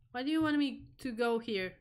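usual speaking voice

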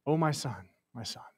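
low, soft grieving father; God. Acted. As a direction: lament a lost son, sinking almost to nothing. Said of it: "Can you hear God say".

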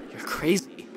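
hushed whispered aside; a stunned whisper of disbelief. Real, not acted. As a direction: breathe it out unvoiced, stunned. Aside reacting to Marty's madness.

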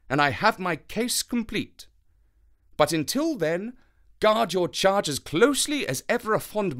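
high, earnest sleuth laying out the danger; Holmes, grave and intent. Acted. Voices Holmes confiding in Watson.

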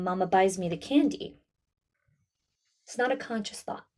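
ordinary talk

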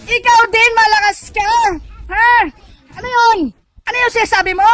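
loud, high jeering shout; yelling back at the opponent; loud taunt. Real, not acted. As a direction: throw it back at them loud. Trash talking opponents in chat.

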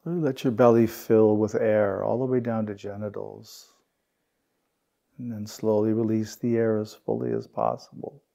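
unhurried meditation guide, long pauses between phrases; guided meditation. Real, not acted. Teacher guiding the group through belly breaths and settling, guided meditation.

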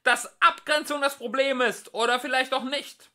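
high, loud shrill demand; shrieking. A narrator's reading. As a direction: keep screeching it. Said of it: "kreischte Frumpf von Deel".

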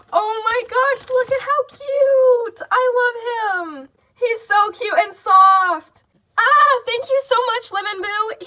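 high, loud, slow gushing over a toy; high, loud, slow happy gushing; excited squeal. Real, not acted. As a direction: squeal with real delight, drawing it out. Pulls out dream Beanie Boo.

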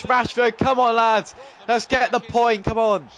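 loud, high cheering on the lads; rally the team through the screen; excited urging. Real, not acted. Gamer urging his side to grab a point.

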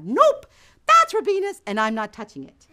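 high, loud nope, settling down; emphatic refusal. Acted. Resists temptation a second time.

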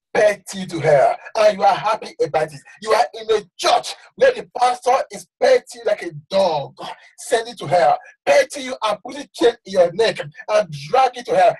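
ordinary talk

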